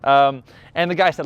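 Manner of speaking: ordinary delivery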